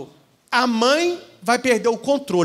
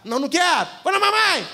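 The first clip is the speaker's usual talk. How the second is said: mock child's shout; 8 semitones higher, faster than usual. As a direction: play the spoiled girl shouting for mom. Child refusing grandma, shouting. (imitando criança mimada gritando alto)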